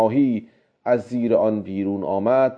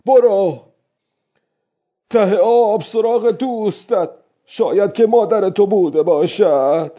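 high, raised voice calling at the shark; loud voice; 10 semitones higher, 4 dB louder than usual. A narrator's reading. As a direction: call it out loudly, pitched well up. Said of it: "با صدای بلند گفت".

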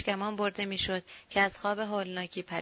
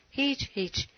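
plain reading voice

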